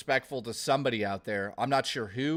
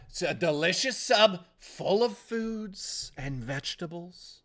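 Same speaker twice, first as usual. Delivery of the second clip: mock ad voice; 4 semitones higher than usual. Mock ad voice on sub.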